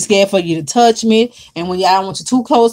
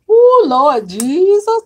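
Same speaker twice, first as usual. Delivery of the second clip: drawn-out exclamation; 7 semitones higher, much slower than usual. Real, not acted. Exclaiming at a viewer's comment.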